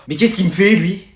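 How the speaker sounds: normal talking voice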